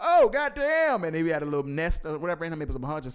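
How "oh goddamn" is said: high, slow drawn-out shocked groan. Retold or imitated. Quoting a movie scene, a high slow shocked groan. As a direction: stretch it out in horror. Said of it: "T I was like".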